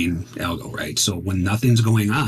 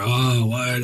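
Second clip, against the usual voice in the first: higher-pitched mock groan of protest; imitated crowd groan; 6 semitones higher than usual. Trader imitating the groans of the webinar crowd, mock groaning. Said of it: "I can hear the groans".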